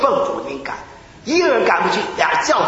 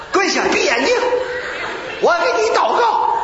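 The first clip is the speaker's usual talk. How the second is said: zealous command; 12 semitones higher than usual. Retold or imitated. Ordering the man, then praying.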